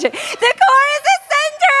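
high-pitched, exaggerated excited cry; really excited. Shown as a demonstration. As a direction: say it like you're thrilled, laughing into it. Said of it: "say it like you're really excited about it".

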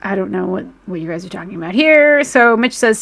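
usual speaking voice

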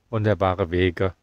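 ordinary talk

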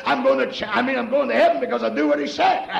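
fervent, rising preacher's cadence, half shouted; preaching at full force. Acted. Preacher to his church, whooping at full force. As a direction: preach it hard to the congregation with music behind.

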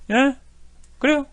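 normal talking voice

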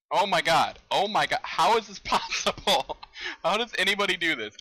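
real frustrated yelling; frustrated outburst. Real, not acted. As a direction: cry out in disbelief at the game. Frustrated outburst at hard game.

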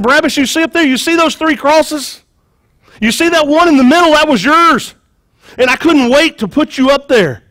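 high, emphatic pointing-out; worked-up soldier. Acted. Soldier shows prisoner the three crosses, high and worked up.

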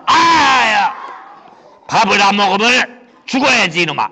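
loud shout of rage; angry yell. Acted. Rich man yelling at the beggar to die. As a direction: bellow at him.

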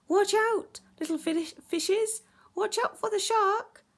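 loud, high call of alarm; high, loud storybook warning; urgent warning cry. Acted. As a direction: shout a warning to the little fish. Narrator cries warning to fish.

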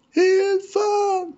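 very high, loud cry for help; panicked chick scream. A narrator's reading. Chick drowning, calls for help.

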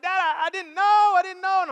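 high-pitched mock wail of apology; imitated crying child. Freed daughter apologizing to her dad, imitated tearful wail. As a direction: plead to dad like a tearful kid. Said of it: "she's crying".

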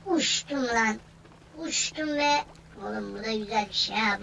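ordinary talk, nothing marked

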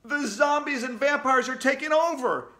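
high-pitched alarmed cry; panicked yell. A narrator's reading. Somebody in the crowded hallway, yelling. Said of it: "yelled somebody else".

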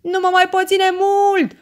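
very high, loud scream while hanging on; desperate yell. A narrator's reading. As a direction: scream it in sheer panic. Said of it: "urlă Meda". (urlet disperat, foarte ascuțit)

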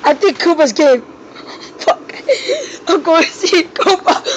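normal talking voice

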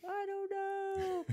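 very high, slow mock wail; mimicked tearful child. Crying toddler asked why she stole, high drawn-out wail. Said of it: "just started crying".

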